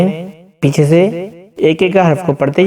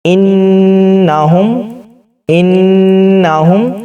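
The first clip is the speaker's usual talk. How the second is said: slow drawn-out syllables; 3 semitones higher, much slower than usual. Shown as a demonstration. Teacher slowly sounding out a joined word for pupils. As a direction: stretch each sound long and slow. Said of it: "آپ ہم بتائیں گے".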